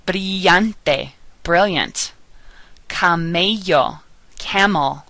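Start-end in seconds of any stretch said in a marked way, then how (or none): 0.1-1.1 Spanish "Brillante" with LL as a Y; double L as Y. Shown as a demonstration. Teacher modelling double L words, Y sound in "Brillante". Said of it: "sounds like the Y in the word yes". 2.9-4.0 slow Spanish "Camello" with LL as Y; double L as Y. Shown as a demonstration. Teacher modelling double L words, Y sound in "Camello". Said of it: "sounds like the Y in the word yes".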